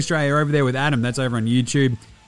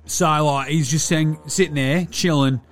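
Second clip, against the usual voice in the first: higher-pitched ocker larrikin, casually enthusiastic; Delly, laid-back Aussie mate; 6 semitones higher than usual. Acted. Player checking in with fans to review a show, laid-back ocker mate. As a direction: play a chilled, sweary bloke reviewing telly.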